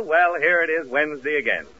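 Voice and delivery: usual speaking voice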